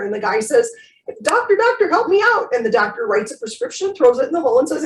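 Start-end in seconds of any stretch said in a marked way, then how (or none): 1.2-2.5 high-pitched mock cry for help; acted plea. Man in the hole begs doctor, high storyteller's plea. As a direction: call up to a passer-by, high and pleading.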